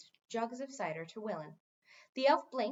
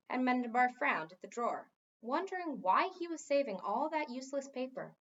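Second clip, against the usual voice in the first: ordinary delivery, nothing marked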